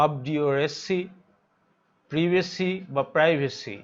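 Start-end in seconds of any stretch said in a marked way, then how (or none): 2.1-2.7 প্রিভেসি with a short i in the first syllable; British প্রিভেসি, opening "priv"; British pronunciation. Shown as a demonstration. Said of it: "British উচ্চারণ হচ্ছে প্রিভেসি". 3.2-3.8 প্রাইভেসি with a long "eye" in the first syllable; American প্রাইভেসি, opening "prive"; American pronunciation. Shown as a demonstration. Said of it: "American উচ্চারণ হচ্ছে".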